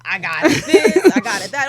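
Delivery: patient piling on complaints, hammed-up; whiny patient. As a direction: list the ailments like a hypochondriac. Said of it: "all of a sudden he got everything wrong".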